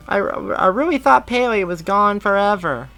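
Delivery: put-on high, bright kid voice; young boy. A narrator's reading. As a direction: play an eager little boy. Boy speaks of lost dog.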